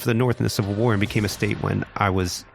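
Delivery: ordinary delivery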